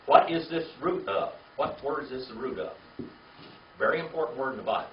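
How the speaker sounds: ordinary delivery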